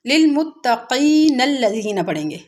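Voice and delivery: लिलमुत्तकीनल्लज़ीना run together without a stop; joined reading. Shown as a demonstration. Teacher demonstrating joining instead of stopping. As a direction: join मुत्तकीन to अल्लज़ीना smoothly. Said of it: "अगर हम इसको मिला के पढ़ेंगे".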